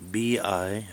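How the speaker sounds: normal talking voice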